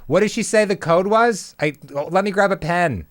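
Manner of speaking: high, eager voice; eager wife voice. Retold or imitated. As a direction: play an eager wife scrambling for a pen.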